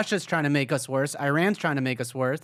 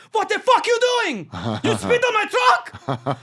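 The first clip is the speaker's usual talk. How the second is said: high, loud mock yell of a huge Russian guy; angry Russian guy; 7 dB louder than usual. Comic retelling of the Russian driver yelling over his truck. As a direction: yell it like the giant guy whose truck got spat on. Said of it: "He's like".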